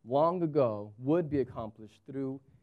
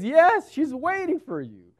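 pointed wife; 11 semitones higher, 8 dB louder than usual. Retold or imitated. Wife's pointed reply to husband.

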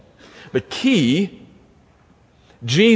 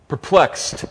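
usual speaking voice

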